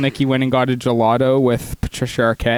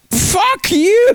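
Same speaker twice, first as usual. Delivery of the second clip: sudden high shout; possessed yell; 4 dB louder than usual. Retold or imitated. Friend reenacts possessed movie character, sudden shout. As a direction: scream it like he's possessed. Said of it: "and goes".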